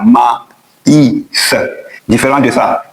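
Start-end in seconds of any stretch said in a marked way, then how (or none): 0.0-1.5 Ma, I, S broken apart with pauses; Ma, I, S in separate, spaced-out pieces; vowels read separately. Shown as a demonstration. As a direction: sound A and I as two vowels in Ma, I, S. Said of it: "se lisent différemment".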